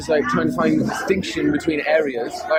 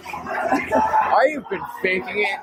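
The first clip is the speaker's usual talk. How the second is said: slow, deliberate complaint; 3 semitones higher, slower than usual. Retold or imitated. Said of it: "I'm like".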